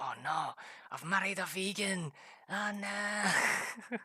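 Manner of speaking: high, hammed-up panic; mock freak-out. Husband retelling his discovery about his wife, mock freaking out. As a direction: wail it in comic horror. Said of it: "Total freaking out and being like".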